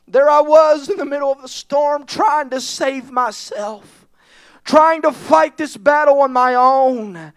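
usual speaking voice

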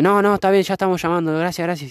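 high-pitched, insistent polite refusal; imitated wary girl. Girls declining the phone offer, imitated high nervous voice. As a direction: mimic a nervous girl waving help away. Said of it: "porque tenían miedo".

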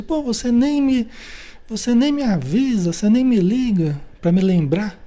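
high, aggrieved blaming; indignant complaint. Retold or imitated. Blaming the friend instead of apologizing, high and aggrieved. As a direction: whine the blame back at them. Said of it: "fala assim".

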